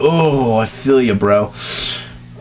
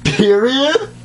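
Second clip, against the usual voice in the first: high squeak; 12 semitones higher than usual. Real, not acted. Reacting to the fic.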